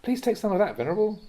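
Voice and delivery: sing-song coaxing offer, mock polite; eager food offering. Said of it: "When you hear the invitation".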